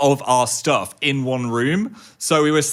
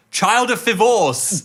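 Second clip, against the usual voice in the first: put-on "Child of Fivorce" joined up, high and drawn out; triumphant reveal; 9 semitones higher, 3 dB louder, slower than usual. Shown as a demonstration. Lands the pun after sounding out.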